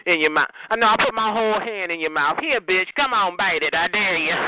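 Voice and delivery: normal talking voice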